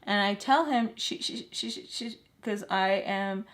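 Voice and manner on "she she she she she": soft repeated stammer; re-enacted stutter, barely voiced; can't get words out. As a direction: imitate being too startled to speak. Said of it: "I can't get the words out".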